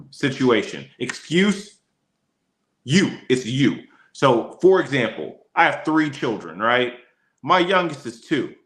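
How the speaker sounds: normal talking voice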